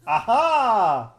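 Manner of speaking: loud high aha of triumph; triumphant aha. Real, not acted. Grabs the bottle in game.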